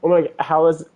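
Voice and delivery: usual speaking voice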